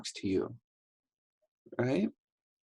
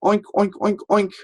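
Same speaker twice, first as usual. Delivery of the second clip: high, loud oinks; storybook pig; 10 semitones higher, 4 dB louder than usual. Shown as a demonstration. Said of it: "pigs usually say".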